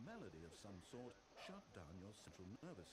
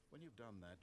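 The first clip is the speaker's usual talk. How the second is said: usual speaking voice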